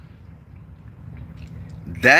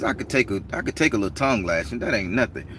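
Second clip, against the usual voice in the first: normal talking voice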